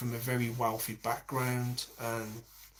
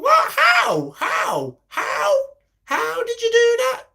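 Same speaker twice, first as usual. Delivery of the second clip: very high, loud disbelieving cries; baffled shriek; 10 dB louder, slower than usual. Retold or imitated. Reacting to the tutorial, shrieking in disbelief. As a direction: shriek in total confusion. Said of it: "I was like".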